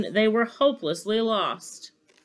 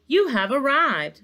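higher, louder navigation announcement; unhelpful Santa Nav; 7 semitones higher, 7 dB louder than usual. A narrator's reading. Santa Nav speaks after the crash, unhelpful announcement. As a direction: announce it like a satnav. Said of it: "said the Santa Nav unhelpfully".

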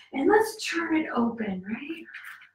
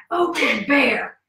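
normal talking voice

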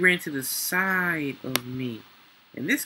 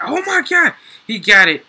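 much higher and louder in sudden shock; real surprise, loud; shocked outburst; 8 semitones higher, 10 dB louder than usual. Real, not acted. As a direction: blurt it out in disbelief. Watching a rival get the kill.

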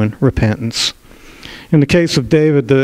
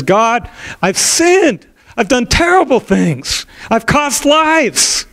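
high, slow, broken confession; anguished confession; 11 semitones higher, slower than usual. Acted. David confessing his sins to God, high slow anguish. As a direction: cry it out to God slowly. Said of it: "he cries out his heart".

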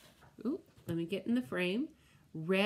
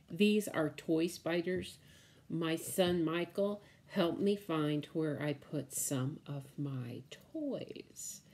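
ordinary delivery

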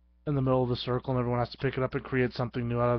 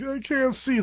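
whiny victim; 12 semitones higher than usual. Pretend. Blinded victim speaking.

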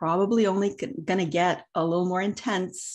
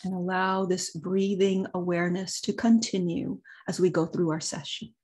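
guided meditation. Acted. Leads a meditation, long pauses.

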